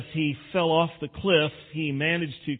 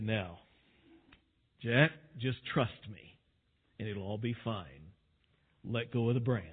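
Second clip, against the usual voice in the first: low, measured, softened divine voice; voice of God; 4 semitones lower, 6 dB quieter than usual. Acted. God tells hanging man to trust him, calm godlike voice. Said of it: "God said".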